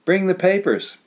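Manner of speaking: high, loud urgent call; shouting up stairs. A narrator's reading. Jana yelling upstairs for the papers. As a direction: yell the order up the stairs. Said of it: "shouted Jana up the stairs".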